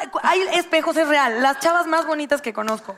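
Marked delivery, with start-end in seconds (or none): none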